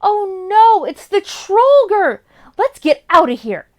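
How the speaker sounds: high, loud cry of alarm; alarmed shout. Acted. Boy shouting as the Trollger growls, loud and high. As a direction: yell it out in panic. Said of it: "and shouted".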